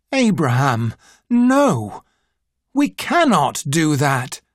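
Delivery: narrated outcry, lightly acted; crying out in protest. A narrator's reading. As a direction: protest with a sharp lift on "no", otherwise restrained. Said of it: "cried Arthur".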